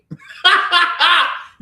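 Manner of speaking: a sharp incredulous laugh; loud laugh. Real, not acted. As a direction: burst of high, loud laughter. Laughing amid rant.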